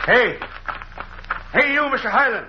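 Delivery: calling out. Acted. Elisha calling to Highland.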